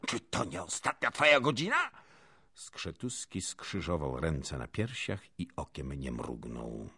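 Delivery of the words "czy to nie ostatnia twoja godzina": high, loud menacing screech; furious noblewoman crying out; princess's shouted threat. A narrator's reading. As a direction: scream the questions as death threats. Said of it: "wołała kniachinia".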